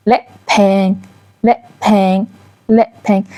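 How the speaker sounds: "ل" very short, "پنگ" held long; short then long. Shown as a demonstration. Teacher contrasting vowel length, short "ل" against long "پنگ". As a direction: clip "ل" brief, then stretch the vowel of "پنگ". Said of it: "این کوتاهه و این بلنده".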